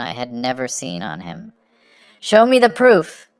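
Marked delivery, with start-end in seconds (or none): 2.2-3.3 much higher, loud demand. A narrator's reading. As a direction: bark the order at him. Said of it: "he demanded".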